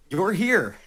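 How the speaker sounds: high, loud and laughing through it; laughing mock indifference. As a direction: shrug off the heckler with a laugh. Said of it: "you take it as".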